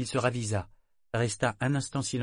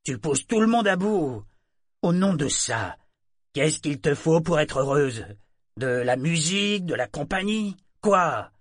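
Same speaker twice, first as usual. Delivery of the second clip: higher and louder, exasperated; annoyance showing; 6 semitones higher, 6 dB louder than usual. Acted. Mate confronting the figurehead, exasperated. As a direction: let the patience crack. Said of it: "l'agacement perçait dans sa voix".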